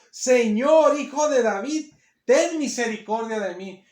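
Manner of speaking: loud, high cry for mercy; acted clamour of a desperate mother; shouted plea. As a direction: cry out, drawing out each phrase. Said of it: "Le llegó gritando, le gritó".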